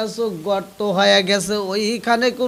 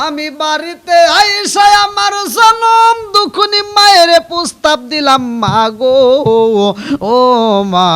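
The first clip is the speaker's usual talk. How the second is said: loud chant starting high, settling into drawn calls; impassioned preaching; 6 semitones higher, 10 dB louder than usual. A narrator's reading. Preacher tells of bringing mother a proposal, impassioned preaching. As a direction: call out to mother in long chanted cries.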